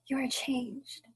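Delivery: soft whispered accusation; sad whisper. Acted. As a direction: barely breathe it, heartbroken. Belle's sad verdict.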